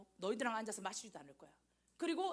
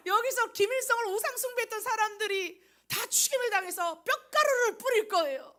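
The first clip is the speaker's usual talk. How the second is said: real laughter bursting through the words, higher and louder; laughing; 9 semitones higher, 9 dB louder than usual. Real, not acted. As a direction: laugh out loud while talking. Laughing at own Pyongyang example.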